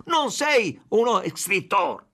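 broken Spanish-Italian, shouted; furious shout. A narrator's reading. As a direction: bellow it in his face. Chief shoving, shouting accusations.

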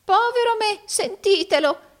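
very high, loud mock exclamation; feigned amusement. A narrator's reading. Arturo teased as jealous by Rodolfo, feigned amusement. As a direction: pretend to find it funny, voice pitched right up. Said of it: "fingendo di ridere".

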